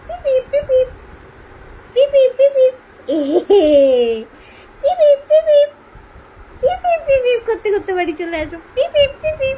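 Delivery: very high, loud toy beeping; car horn beep. Acted. Mother imitating a car horn for the baby.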